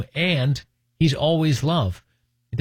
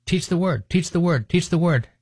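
higher-pitched imitation of a daughter parroting; 5 semitones higher than usual. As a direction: chant the command back by rote, higher. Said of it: "don't repeat the command".

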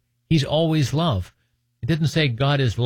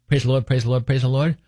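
mock rote repetition. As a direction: imitate someone parroting a phrase in a loop. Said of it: "not repeat the command".

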